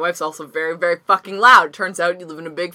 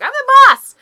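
put-on high, loud spoiled-kid shout; mock a bossy little sister; bratty child; 11 dB louder than usual.

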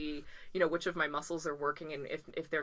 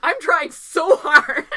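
high, loud, slow laugh-strained whine; 11 semitones higher, 14 dB louder, much slower than usual. Retold or imitated. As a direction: squeeze the words out through laughter as if pushing hard. Straining against trainer's push, laughing.

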